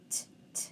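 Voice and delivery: "t" as a bare voiceless tap; two isolated "t" sounds with no vowel; sounding out. Shown as a demonstration. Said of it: "So let's sound it out".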